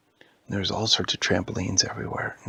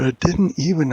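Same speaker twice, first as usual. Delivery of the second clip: higher, louder protest; 6 semitones higher, 7 dB louder than usual. Acted. Elvie denies it, loud high protest. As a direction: insist loudly. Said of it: "Elvie said".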